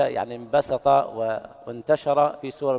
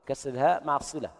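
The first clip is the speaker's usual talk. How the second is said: ordinary delivery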